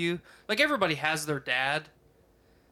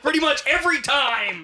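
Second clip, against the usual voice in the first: high, raised dad yell; loud mock scolding; 9 semitones higher, 7 dB louder than usual. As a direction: drive the telling-off home at full voice. Mock-dad scolding, cut by laughter.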